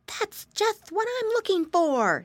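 put-on high, curious piggy boy; little pig voice. A narrator's reading. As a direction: wonder aloud like an eager piglet. Flop finds the stone.

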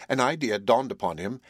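usual speaking voice

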